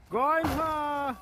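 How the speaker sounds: normal talking voice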